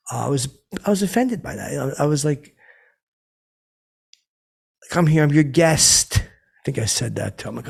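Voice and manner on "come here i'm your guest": put-on loud, slow, drunk over-enunciation; drunk over-enunciating. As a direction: lean hard on every word, loud and slow. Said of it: "over enunciate".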